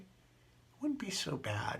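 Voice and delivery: slow, soft whisper. Real, not acted. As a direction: breathe it out slowly. Telling of being very sick.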